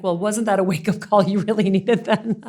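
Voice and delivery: teasing comeback breaking into a laugh; laughing mock scolding; joking. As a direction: deliver the punchline high and loud, amused. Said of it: "has a really hilarious sense of humor".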